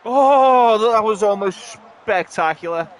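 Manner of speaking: loud, high burst easing down; loud near-miss outcry. Real, not acted. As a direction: cry out at an almost-goal. Reacting to a near goal.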